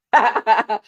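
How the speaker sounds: quick burst of real laughter; amused staccato laugh; short cackle. Real, not acted. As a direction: crack up mid-sentence. Laughs while trying to continue.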